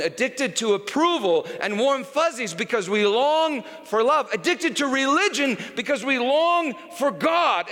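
preaching at full force. Acted. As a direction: drive it home like a revival preacher. Builds to preaching climax.

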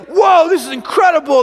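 loud, high amazed shout; awed excitement. Retold or imitated. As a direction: marvel aloud at the spectacle. Acting out Elijah amazed at fire.

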